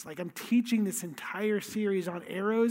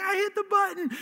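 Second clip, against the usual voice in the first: high-pitched imitation of an excited yell; yelled retort; 11 semitones higher, faster than usual. Nephew answers screaming uncle on the sky swing, high yell. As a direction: act out the nephew calling back, very high. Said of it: "I'm screaming my head off".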